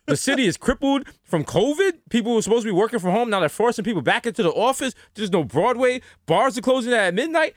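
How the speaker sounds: high-pitched, incredulous listing of disasters; mock appalled speech. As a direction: rant in pretend horror to the new graduates. Mock graduation speech, listing the city's woes.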